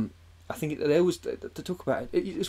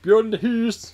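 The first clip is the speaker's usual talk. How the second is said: high, loud fake-Swedish gibberish; badly faked Swedish, pitched up; silly Swedish-style singsong; mock Swedish accent; 9 semitones higher, 7 dB louder, slower than usual. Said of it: "He's not even Swedish".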